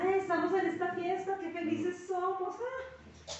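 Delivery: high, slow, sing-song mocking glee; preacher mocking the sinner's cheerful party voice. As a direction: parody a careless partygoer.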